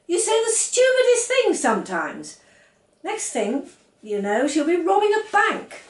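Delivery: exasperated wife telling him off; exasperated scolding. A narrator's reading. Wife scolding husband over his silly promise, exasperated.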